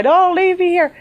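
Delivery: a high, loud desperate yell upward; screaming to the sky. Retold or imitated. Woman alone on a country road, screaming at the sky. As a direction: scream it at the sky like it's leaving you. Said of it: "screaming in the middle of the sky".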